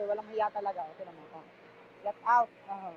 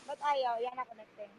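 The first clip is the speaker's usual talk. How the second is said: ordinary talk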